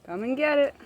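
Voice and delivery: loud feeding call; calling chickens. Real, not acted. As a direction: call the hens to eat.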